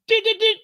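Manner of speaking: very high imitated card reader beeps; card reader beeping. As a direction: squeak out the machine's error beeps.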